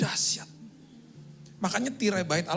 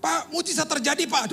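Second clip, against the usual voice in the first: high, bright-news phone voice, much higher; excited caller; 11 semitones higher, 4 dB louder than usual. Retold or imitated. Family member on the phone announcing a miracle, excited and high. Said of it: "ngomongnya gini".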